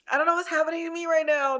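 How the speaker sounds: quick, high-pitched tearful bafflement; emotional. Real, not acted. Host apologizing to listeners for losing it, emotional. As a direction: blurt it out, overcome and confused. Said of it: "I'm getting so emotional".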